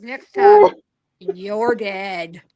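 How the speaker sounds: loud burst on next time, then a low drop; threat. Retold or imitated. Facing down the drunk gunman, a loud mock threat. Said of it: "I said".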